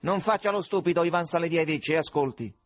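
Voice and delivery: high, pressing nasal caller. A narrator's reading. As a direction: order him sharply through the nose. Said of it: "con un'antipaticissima voce nasale". (voce nasale antipatica, acuta e incalzante)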